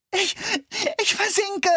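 high, stammering desperate cry; a sinking girl crying out in panic. A narrator's reading. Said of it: "schrie sie verzweifelt".

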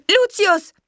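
high, loud urgent call. A narrator's reading. Witch summons her stag beetle, calling out urgently. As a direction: call to someone far off. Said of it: "rief sie".

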